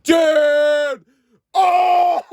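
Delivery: high, loud ecstatic cry; God shouting in awe. Acted. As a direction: yell out amazed. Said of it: "And God's like".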